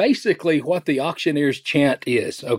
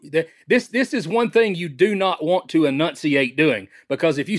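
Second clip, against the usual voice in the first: ordinary talk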